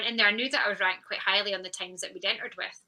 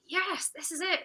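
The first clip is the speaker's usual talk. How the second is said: reliving the joy; 9 semitones higher, slower than usual. Retold or imitated. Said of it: "I was like".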